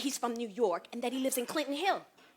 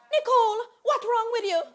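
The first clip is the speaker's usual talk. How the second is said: high, loud mother's demand; alarmed mother; 6 semitones higher, 6 dB louder than usual. Retold or imitated. Jamaican mother questioning her crying daughter, alarmed. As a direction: demand an answer as a worried mother, voice raised. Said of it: "And my mother's like".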